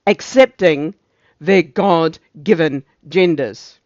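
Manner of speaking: loud, slow, word-by-word proclamation; emphatic officer's decree. Acted. Officer pronouncing on God-given genders, loud and deliberate. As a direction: hammer out each word like a decree. Officer declaims end of scripted sermon.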